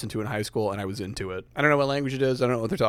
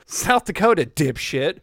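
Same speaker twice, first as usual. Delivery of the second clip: loud smug retort; 4 semitones higher, 4 dB louder than usual. Acted. Player snaps back at dealer.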